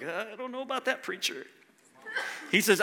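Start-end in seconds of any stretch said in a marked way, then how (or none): 0.0-1.5 hesitant mumble. Retold or imitated. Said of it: "You're like".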